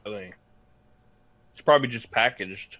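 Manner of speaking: ordinary talk, nothing marked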